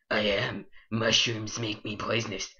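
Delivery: ordinary delivery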